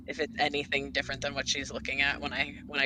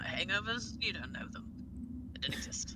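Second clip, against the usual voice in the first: conspiratorial hush; 4 semitones higher, 9 dB quieter than usual. Acted. Cleric's confiding aside on the Sassfather.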